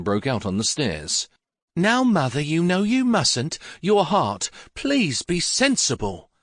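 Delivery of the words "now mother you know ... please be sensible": higher, pleading woman's voice, slow on the warnings; fretful younger woman begging mother to be sensible; anxious daughter-in-law. Acted. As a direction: coax a frail old mother back, worried.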